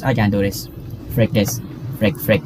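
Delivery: normal talking voice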